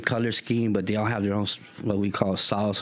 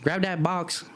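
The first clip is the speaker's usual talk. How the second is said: high, curt orders; lady ordering the kid to carry boxes; brisk boss lady; 9 semitones higher than usual. Retold or imitated. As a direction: bark quick orders. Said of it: "She's like".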